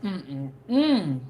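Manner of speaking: savoring food while eating. Real, not acted. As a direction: mouth full, tasting something delicious. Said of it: "This is so good".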